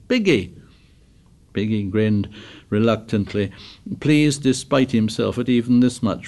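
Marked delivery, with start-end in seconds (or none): 0.1-0.5 high, mocking delighted cry; gleeful teasing. A narrator's reading. Ralph teases from the sand at Piggy's feet, gleeful. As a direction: squeal the nickname in glee. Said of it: "lay there laughing".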